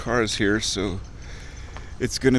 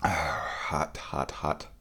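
quiet; 6 semitones lower, 9 dB quieter, much slower than usual. Real, not acted. Sipping hot tea.

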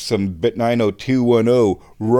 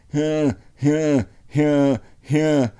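mock panic panting; 4 semitones higher, slower than usual. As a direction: fake hyperventilating huffs. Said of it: "I'm freaking out".

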